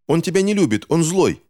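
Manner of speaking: put-on high, frantic child's outcry; yelling. A narrator's reading. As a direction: blurt it out high and insistent. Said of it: "завопил Финч". (отчаянный детский вопль)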